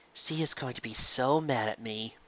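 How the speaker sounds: despairing murmur; mourning. Acted. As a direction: lament it quietly. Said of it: "Lyra's voice mourned".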